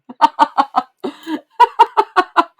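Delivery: high, loud staccato laugh; hard laughing. Real, not acted. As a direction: burst out laughing at your own remark. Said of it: "Why am I laughing so hard".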